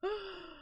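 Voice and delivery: high, soft laughter; a helpless high giggle. Real, not acted. Laughing at what's happening in her brain, high laugh.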